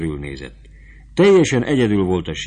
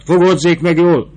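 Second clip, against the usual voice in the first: high, loud shout; 10 semitones higher, 10 dB louder than usual. Acted. Fabrizio shouts at the sutler woman to hold on, loud. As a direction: yell the warning at her. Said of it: "kiáltott rá".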